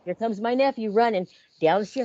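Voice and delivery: usual speaking voice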